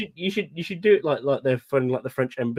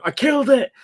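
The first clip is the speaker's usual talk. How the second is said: mock-triumphant yell, pitched way up; triumphant shout; 12 semitones higher, 7 dB louder than usual. Friend mocking him yelling in triumph at the mower. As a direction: crow it like a hard-won victory. Friend found stuck fixing lawnmower.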